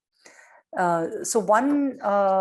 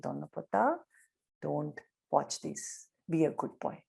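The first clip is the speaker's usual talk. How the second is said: gentle hushed advice; 3 semitones lower, 8 dB quieter than usual. Retold or imitated. Swami takes the boy aside.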